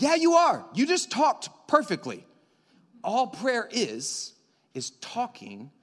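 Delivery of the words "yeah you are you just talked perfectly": eager reassurance. Retold or imitated. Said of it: "And I'm like".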